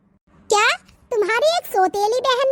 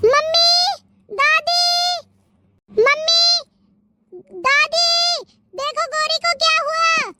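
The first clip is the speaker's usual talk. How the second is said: high, drawn-out alarmed calls; yelling for help; 5 semitones higher than usual. Acted. Girl calls family to her collapsed sister, alarmed yelling. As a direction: call out urgently to mother and grandmother. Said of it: "अरे क्यों चिल्ला रही है".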